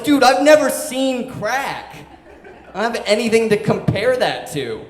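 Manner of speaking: high, loud, exasperated protest; retold outburst of a stunned teenager; loud disbelief. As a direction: shout it in comic bewilderment. Retold stunned reply to boss.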